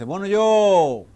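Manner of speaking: loud, high, drawn-out interjection; self-conscious butting in. Acted. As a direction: break in loudly, stretching the words. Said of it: "ya medio acomplejado, dice". (interrupción acomplejada, fuerte y alargada)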